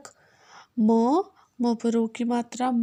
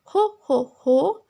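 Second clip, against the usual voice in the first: jolly rabbit shout; 2 semitones higher, 6 dB louder, slower than usual. Acted. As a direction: belt it out like a jolly storybook rabbit. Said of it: "खरगोश बोला".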